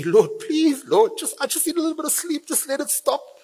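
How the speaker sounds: high, hushed desperate begging for sleep; pleading prayer. Pretend. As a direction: plead with the Lord, half breathless. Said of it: "And you pray".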